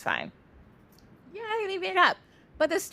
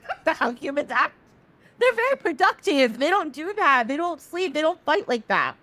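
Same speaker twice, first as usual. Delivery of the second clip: higher, emphatic insistence; indignant protest; 7 semitones higher than usual. Retold or imitated. Sun correcting Moon about humans, indignant and higher. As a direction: rise in pitch as you insist.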